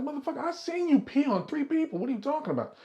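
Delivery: high-pitched indignant protest; retold flabbergasted high voice; acted incredulity. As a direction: squeal it in mock outrage. Retold indignant reply to a denial.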